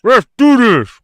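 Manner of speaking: loud, words blurred by a mouthguard; wearing a mouthguard. Real, not acted. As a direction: mouth full of gum shield. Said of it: "please talk about it without the gum shielding".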